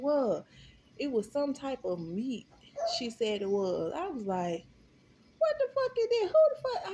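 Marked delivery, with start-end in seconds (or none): 5.4-6.9 much higher and louder, outraged; shrieking disbelief. Retold or imitated. Staring at the strange meat, shrieking in outrage. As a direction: scream it in horror.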